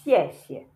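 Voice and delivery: "xiexie" falling, then a short flat xie; neutral tone. Shown as a demonstration. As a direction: say "xiexie" with a toneless last syllable. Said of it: "said like there is no change in the sound".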